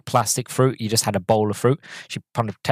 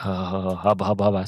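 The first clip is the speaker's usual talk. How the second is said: lower, softer admiring hubba hubba; mock lustful murmur at the handsome man; 5 semitones lower, 4 dB quieter than usual. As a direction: ogle him with your voice. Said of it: "she's just like".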